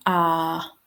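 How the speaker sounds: a long open "ah" with no R; sustained "ah", R left silent; vowel demonstration. Shown as a demonstration. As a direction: sound out "ah" on its own. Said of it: "A R here".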